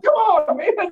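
very high squeaky come on man; mock frustrated whine. Retelling the extinguisher failing, squeaky high frustration.

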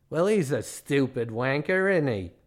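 scornful put-on Australian accent; mean Australian guide. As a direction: sneer in a broad Australian accent. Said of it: "the tale of the meanest man in Australia".

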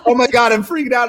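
frantic squeal of alarm; panicked. Retold or imitated. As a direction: retell the panic as if it is happening now. Panicked retelling of draft time.

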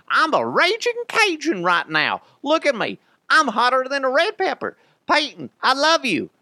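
high, loud Cajun bellow; hammed-up raging Bayou boast; Brandon Stokley Cajun voice. Host doing his signature Stokley line in a loud, high Cajun voice. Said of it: "I'll just do".

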